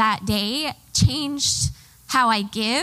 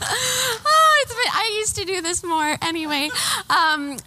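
tearful, higher voice breaking; choked up; 8 semitones higher than usual. Real, not acted. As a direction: fight back real tears. Overwhelmed speaking to the church.

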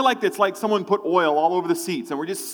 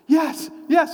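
high, exultant cries of yes; elated relief; 10 semitones higher than usual. Retold or imitated. Man freed of his burden after prayer, elated. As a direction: celebrate it, pitched well up. Acted relief as weight lifts.

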